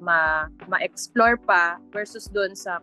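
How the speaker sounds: usual speaking voice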